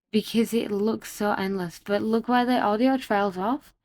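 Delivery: usual speaking voice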